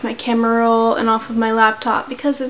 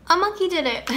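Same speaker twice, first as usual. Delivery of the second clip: little brother's declaration; 7 semitones higher, 4 dB quieter than usual. Acted. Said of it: "took a big step forward and said".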